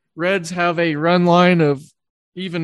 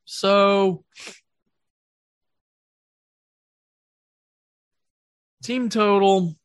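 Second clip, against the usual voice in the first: talking right after a sip of coffee; words around a mouthful of coffee; while sipping coffee; 3 semitones higher, much slower than usual. Real, not acted. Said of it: "Excuse me as I drink some coffee".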